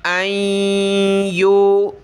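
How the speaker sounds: usual speaking voice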